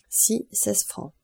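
drawn out as if writing it down; slow dictation. A narrator's reading. Son noting each fare with his pen, slow and soft. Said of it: "Albert prit une plume et écrivit".

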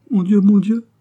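anguished little appeal to God; murmured lament. A narrator's reading. Concepcion laments that he doubted her, high and distressed. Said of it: "murmura-t-elle".

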